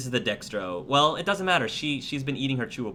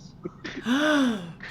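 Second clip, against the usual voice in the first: sharp high gasp of amazement; excited gasp; 13 semitones higher than usual. Acted. Girl hearing Anar trained Blasto, with a high excited gasp. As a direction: gasp like a thrilled child. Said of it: "She gasps".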